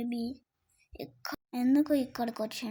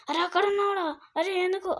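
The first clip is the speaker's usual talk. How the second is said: high, startled call on spotting him; sudden higher-pitched outburst; startled alert; 7 semitones higher, 3 dB louder than usual. Real, not acted. Player spots the enemy in the game, startled.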